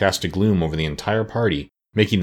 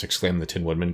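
ordinary delivery, nothing marked

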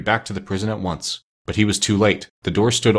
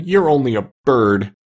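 slow, louder wail; hammed-up weepy piglet; starting to cry; 3 semitones higher, 5 dB louder than usual. A narrator's reading. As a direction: whine it out tearfully, drawn out. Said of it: "beginning to cry again".

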